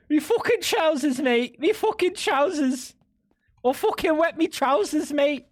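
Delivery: high-pitched caricature British voice; mock British accent. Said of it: "I think it's just a British thing".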